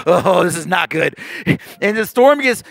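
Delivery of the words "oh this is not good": low, queasy seasick groan. Acted. Disciples in a rocking boat, seasick. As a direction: act like you're about to be sick. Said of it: "Show me your seasick face".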